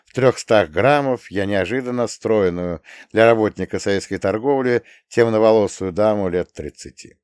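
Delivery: ordinary delivery, nothing marked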